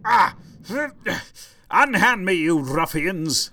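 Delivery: theatrical outrage, slow on ruffians; hammy stage actor. Acted. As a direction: overplay the captive nobleman. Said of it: "captured by pirates".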